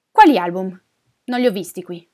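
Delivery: loud, brusque retort; angry denial. Acted. Neighbour disputing the returned owner's claim, angry denial. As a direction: throw the question back loudly. Neighbour denies in acted quarrel.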